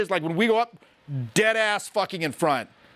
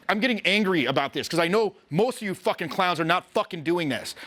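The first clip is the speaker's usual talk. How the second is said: ordinary talk, nothing marked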